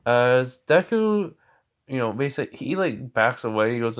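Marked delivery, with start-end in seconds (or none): none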